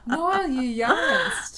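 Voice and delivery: slow, high, laughing accusation; laughing disbelief. Real, not acted. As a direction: draw it out laughing. Incredulous at friend's yawn.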